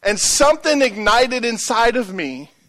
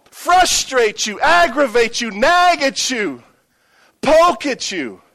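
preaching challenge; 5 semitones higher than usual. Acted. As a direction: challenge the church from the pulpit. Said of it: "and I'm saying".

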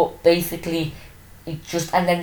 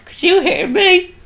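calling to check; 5 dB louder, slower than usual. Real, not acted. Checking mic volume.

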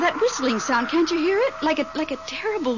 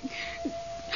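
frightened gasp; 14 dB quieter than usual. Acted. Something runs through the room.

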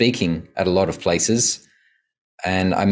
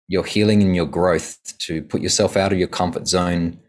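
normal talking voice

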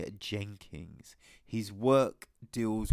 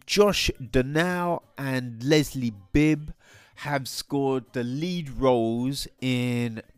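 usual speaking voice

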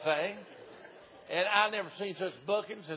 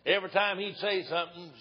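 normal talking voice

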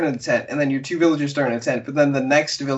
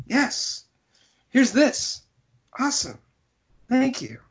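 high-pitched, playful gratitude; imitating a thrilled little thank-you; mock delight; 11 semitones higher than usual. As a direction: accept gifts in a high, acted voice. Mock delight at villager gifts.

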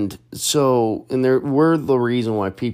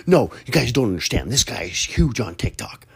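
quick, lightly acted starstruck insistence; fangirling; 3 semitones higher than usual. Fan telling her friends about him, fangirling. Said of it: "she started, like, fangirling".

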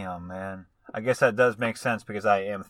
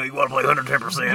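Brendan Fraser voice. Retold or imitated. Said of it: "that Brendan Fraser voice when he played basketball".

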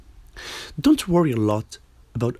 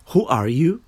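ordinary talk, nothing marked

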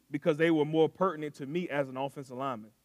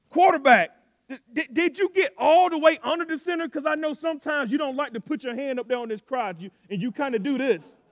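loud, higher coach questions; coach grilling quarterback; 8 semitones higher, 7 dB louder than usual. Retold or imitated. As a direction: call out the quarterback sharply.

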